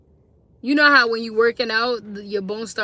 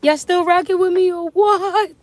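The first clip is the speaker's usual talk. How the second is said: higher, louder call to the chat; 8 semitones higher, 6 dB louder than usual. Real, not acted. Calling out to her silent live chat, loud and high. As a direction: shout it up to the viewers.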